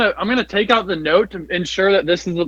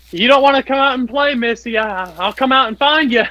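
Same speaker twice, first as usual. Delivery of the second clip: higher, a hint of teasing singsong; mild taunt; 6 semitones higher than usual. Player taunting the ghost girl, lightly mocking. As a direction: coax her out playfully.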